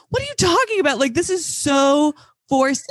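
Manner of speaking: high-pitched incredulous protest. Acted. Podcaster mocking forced romance, incredulous outburst. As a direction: blurt it high, then drag out the complaint. Mock outrage at the scene.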